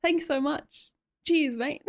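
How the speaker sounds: high, friendly Aussie greeting; exaggerated Aussie street hello; turned-up Aussie accent. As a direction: lay on the Australian accent for passers-by. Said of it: "the Aussie filter turns up just a bit".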